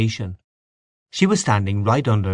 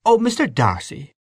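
high, reproachful exclamation; crying out; 8 semitones higher than usual. A narrator's reading. Mary Jane reproaching the singer, high cry. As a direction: cry it in a raised character voice. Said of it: "cried Mary Jane".

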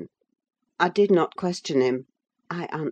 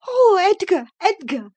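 very high, loud breathless cry; panted excitement; 7 dB louder than usual. A narrator's reading. Wife rushes in to her husband, panted high cry. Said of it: "she panted".

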